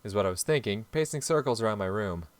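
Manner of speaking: reading dialogue and narration aloud, lightly acted; visual novel reading. A narrator's reading. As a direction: read the story out in a steady voice.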